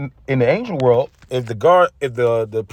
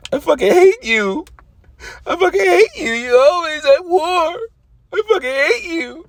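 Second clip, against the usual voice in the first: crying, much higher shriek of a furious wife; screaming wife; 13 semitones higher than usual. Retold or imitated. Soldier's wife screaming at her absent husband, crying and shrill. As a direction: wail it at him through tears. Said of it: "his wife was probably fucking".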